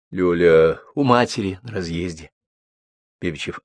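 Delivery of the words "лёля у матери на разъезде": plain reading voice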